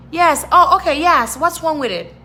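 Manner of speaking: relieved agreement. Retold or imitated. Shopper and clerk finally understanding each other, relieved and high.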